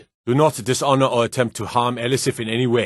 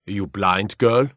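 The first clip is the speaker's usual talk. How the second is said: ordinary delivery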